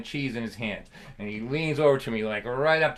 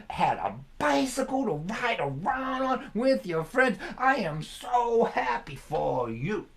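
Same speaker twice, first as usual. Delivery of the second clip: very slow, higher, sarcastic sing-song; dripping sarcasm; 8 semitones higher, slower than usual. Retold or imitated. Father finishing off his son, slow sing-song sarcasm. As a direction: stretch it out, soaking every word in sarcasm. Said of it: "dripping with sarcasm".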